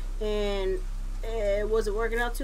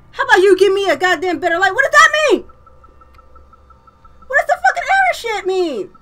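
high, loud, exasperated demands; angry yelling; 10 semitones higher, 11 dB louder than usual. Real, not acted. Player left without light, angry high-pitched yelling. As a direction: shout your frustration at the game.

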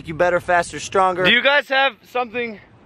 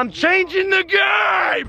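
high, slow triumphant yell; hyped shout; 11 semitones higher, much slower than usual. Real, not acted. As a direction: shout it like a victory cry. Hyped echo of praise.

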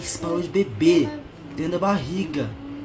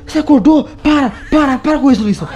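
loud, higher pleas to stop; urgent shouting; 6 semitones higher, 11 dB louder than usual. Real, not acted. Husband yelling at Luísa to stop, loud and urgent. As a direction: shout her name to snap her out.

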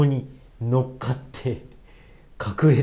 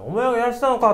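accusing demand; 8 semitones higher, 3 dB louder, faster than usual. Retold or imitated. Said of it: "問い詰めたらしい".

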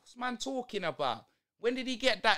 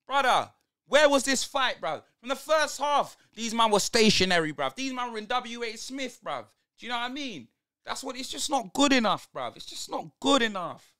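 loud, higher, exasperated yelling at the players; furious rant; 4 semitones higher, 9 dB louder than usual. Real, not acted. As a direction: shout your disgust at the pitch. Furious at team's late fight.

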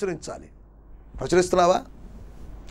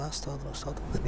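mocking a barely audible prayer, much softer and lower; tiny mumbled praise; 7 semitones lower, 14 dB quieter than usual. Pastor mocking a timid girl's church prayer, faint mumble. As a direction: mimic a shy girl whispering stotram under her breath. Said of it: "ప్రార్థన చేయమంటే చిన్నగా".

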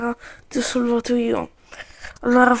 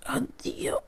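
dying whisper; 14 dB quieter than usual. Pretend. Toy soldier dying farewell.

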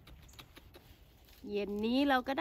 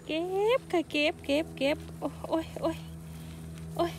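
excited; 5 semitones higher than usual. Real, not acted. Picking mushrooms, squealing with joy.

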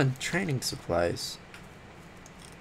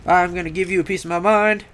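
mock challenge call; 5 semitones higher, 3 dB louder than usual. Player taunting Zhao Min Ruo, mock challenge call. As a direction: call out the foe, hammed-up. Calls out to game opponent.

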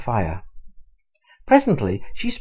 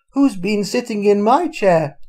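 raised, louder Mother Bear voice; middle-sized bear voice; 7 semitones higher, 5 dB louder than usual. A narrator's reading. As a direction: call out higher, as indignant Mother Bear. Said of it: "she said in her middle-sized voice".

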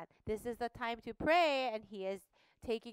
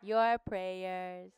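angel's announcement; 5 dB louder, slower than usual. Acted. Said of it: "Say it".

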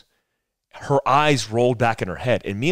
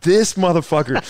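higher, louder mock outrage; boy realising his cousin faked the fall; incredulous; 7 semitones higher, 6 dB louder than usual. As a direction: catch your cousin faking it. Cousin smiles, faking the fall.

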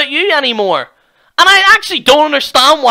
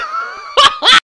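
very high wailing cackle; hammed-up high wail; mock crying shriek. End of mock meltdown.

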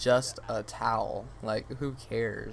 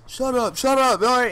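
loud, raised-pitch imitation of a commanding cop; mock cop shout; 11 semitones higher, 10 dB louder than usual. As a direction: shout it like a cop trying to intimidate. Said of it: "super duper deep voice".

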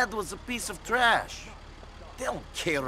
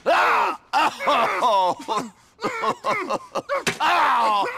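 loud high cries and yelps of pain; pained screams; 7 semitones higher, 8 dB louder, much slower than usual. Acted. Captive screaming as the torturer hurts him. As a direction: howl through the torture.

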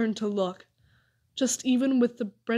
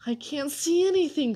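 higher-pitched complaint; mild grumbling complaint; complaining; 5 semitones higher than usual. A narrator's reading. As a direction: whine it a little, pitch lifted. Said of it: "complained Amos".

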